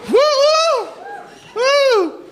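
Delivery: high party whooping; short repeated high woos; crowd whoop. Real, not acted. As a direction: whoop along with a rowdy crowd.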